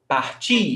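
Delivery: partir stressed on its final "tir"; stress on last syllable. Shown as a demonstration. Teacher contrasting verb forms for a student, last-syllable stress. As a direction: put the weight on the end of partir.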